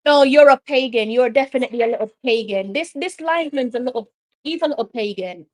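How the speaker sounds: ordinary talk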